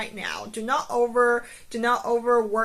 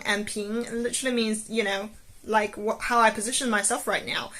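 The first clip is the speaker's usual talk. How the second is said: ordinary delivery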